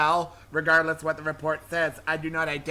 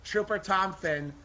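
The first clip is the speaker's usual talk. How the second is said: usual speaking voice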